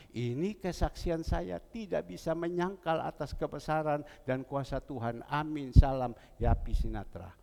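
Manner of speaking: ordinary talk, nothing marked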